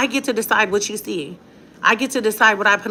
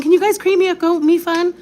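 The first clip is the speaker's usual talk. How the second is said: mock begging; 10 semitones higher, 3 dB louder than usual. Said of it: "I'm not saying".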